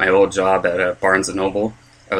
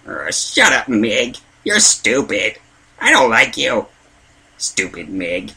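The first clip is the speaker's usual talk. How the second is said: Chris from Family Guy; 3 semitones higher, slower than usual. Acted. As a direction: play a slow-witted cartoon kid grumbling. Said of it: "I do a Chris from Family Guy".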